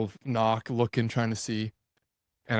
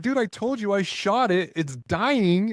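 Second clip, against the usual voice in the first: exasperated; 9 semitones higher, 4 dB louder, slower than usual. Retold or imitated. Exasperated retort.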